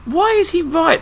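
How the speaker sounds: high, loud mock outrage; indignant. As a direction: squeak it out, outraged.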